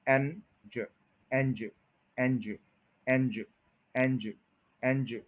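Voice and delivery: एंज said soft and light; soft unstressed एंज drill; unstressed syllable. Shown as a demonstration. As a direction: drop your voice on एंज. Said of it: "इनके ऊपर कोई stress नहीं है".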